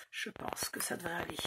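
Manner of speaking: breathy whisper through pain. Real, not acted. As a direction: whisper it to yourself. Whispered aside while in pain.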